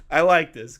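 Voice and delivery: usual speaking voice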